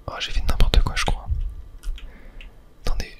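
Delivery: usual speaking voice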